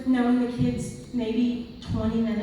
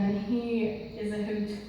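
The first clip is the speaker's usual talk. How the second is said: usual speaking voice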